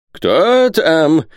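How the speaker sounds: high, loud challenge to strangers; calling out. A narrator's reading. Isaac to visitors at his hatch, calling out. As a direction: cry out in a high old man's voice. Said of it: "крикнул он".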